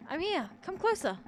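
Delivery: high, urgent cry for attention; screamed call. A narrator's reading. Trapped narrator answers the voice behind the door with a high, urgent call. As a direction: call out desperately, pitch climbing. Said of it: "I scream thrice, as hard as before".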